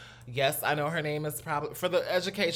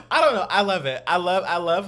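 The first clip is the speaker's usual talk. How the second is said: excited; 4 semitones higher, 10 dB louder than usual. Real, not acted. Gushing about photo shoot.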